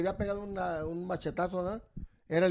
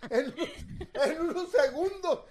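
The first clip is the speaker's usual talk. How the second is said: high, slowed words squeezed out between laughs; real giggling, voice pitched up; talking through laughter; 6 semitones higher, slower than usual. Real, not acted. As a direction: tell it while cracking up. Still laughing at own story.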